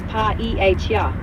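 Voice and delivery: "pa-E-H-R" sounded out slowly, syllable by syllable; slow spelled-out syllables. Shown as a demonstration. Tutor slowly spelling the word for the learner. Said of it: "paella is pronounced".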